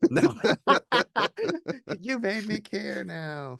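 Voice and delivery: slow words through a real laugh; slow, laughing you-made-me-care. Real, not acted. Laughing at the joke.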